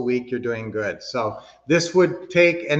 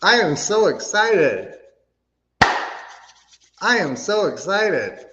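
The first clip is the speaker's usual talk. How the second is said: higher, slow and emphatic, still pumped; excited affirmation; 4 semitones higher than usual. Shown as a demonstration. Coach repeating the group affirmation, slow and excited. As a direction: stretch each word out with conviction. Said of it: "I want you to do a clap and a rub".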